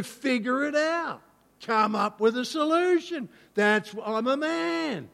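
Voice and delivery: high, loud rallying sermon voice; preaching at full force. Acted. Preacher firing up the congregation about men fixing things, full force. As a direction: build it up like a rousing sermon.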